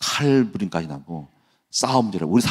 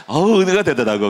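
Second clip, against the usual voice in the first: ordinary talk, nothing marked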